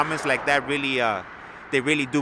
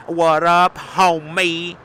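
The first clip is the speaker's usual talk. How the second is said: "What up, homie?" called out higher and louder; loud greeting demo; 6 semitones higher, 10 dB louder, much slower than usual. Shown as a demonstration. Teaching viewers how to greet homies, loud hearty call. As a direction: belt it like hailing a friend across the street. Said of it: "we also use".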